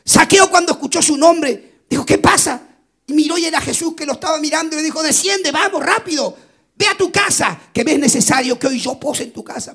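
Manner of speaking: lively preaching; urgent preaching. Acted. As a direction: call out the moment with urgency. Preacher narrating Jesus calling.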